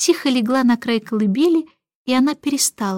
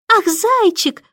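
higher, gushing little voice; cooing; 8 semitones higher, 3 dB louder, slower than usual. A narrator's reading. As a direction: coo over the baby. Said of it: "вторила Барбара".

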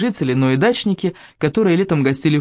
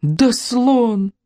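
put-on exasperated old woman's cry; grandma close to tears; upset grandmother; 7 semitones higher than usual. Grandma answers, near tears.

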